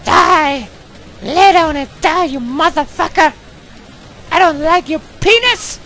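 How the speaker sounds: put-on a falsetto girl persona ranting in English at the game. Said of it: "I'm playing the English girl right now".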